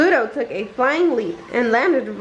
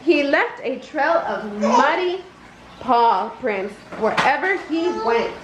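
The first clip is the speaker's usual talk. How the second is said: ordinary delivery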